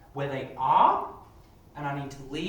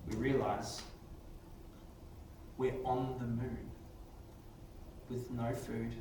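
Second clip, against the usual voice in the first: story narration; 3 semitones lower, 4 dB quieter than usual. Acted. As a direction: narrate the story to the class with suspense. Teacher narrates model story.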